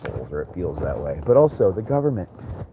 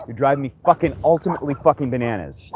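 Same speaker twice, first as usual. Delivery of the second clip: ordinary talk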